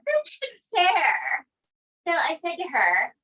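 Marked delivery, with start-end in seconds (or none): 0.1-1.5 high, drawn-out mock complaint; imitated daughter's whine. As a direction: whine it slowly like a wronged daughter. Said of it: "So his daughter goes".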